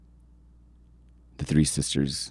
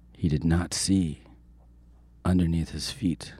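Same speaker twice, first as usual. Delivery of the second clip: ordinary delivery, nothing marked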